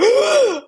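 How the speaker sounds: sharp high gasp of shock. Retold or imitated. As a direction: gasp like a room of stunned nurses. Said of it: "like, all the nurses, like".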